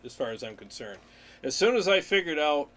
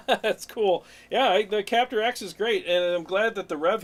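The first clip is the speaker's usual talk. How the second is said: laughing reply; 5 semitones higher, 5 dB louder than usual. Real, not acted. Reacting to chat.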